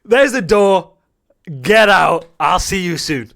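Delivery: loud, high shout easing at the end; acted furious dismissal. Coach showing a star player the door, shouting in mock fury. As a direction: yell it, then drop to a curt send-off.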